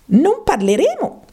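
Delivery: high-pitched, incredulous mouse; indignant cry. Acted. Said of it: "gridò il topo".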